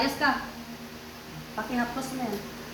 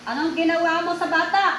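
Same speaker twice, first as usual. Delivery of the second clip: higher, forceful interrogation, rising at the end; demanding; 4 semitones higher, 4 dB louder than usual. Real, not acted. Healer demands what was done to the child, demanding. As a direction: demand an answer, voice raised.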